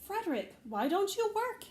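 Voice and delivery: chirpy little mice asking. Acted. Mice question Frederick.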